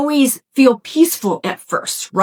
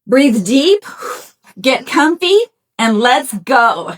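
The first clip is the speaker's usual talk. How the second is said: usual speaking voice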